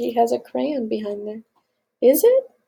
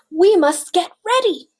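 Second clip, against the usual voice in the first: higher, louder eager call; rally them with excitement; excited urging; 7 semitones higher, 6 dB louder than usual. Acted. Farmer rallying the cows for school, excited.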